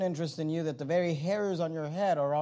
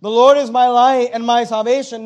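proclaimed aloud; 3 semitones higher, 10 dB louder than usual. Shown as a demonstration. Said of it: "I hope you can say that tonight".

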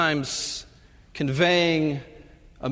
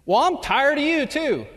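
put-on cranky old wife; 13 semitones higher, 4 dB louder than usual. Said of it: "Mabel turns".

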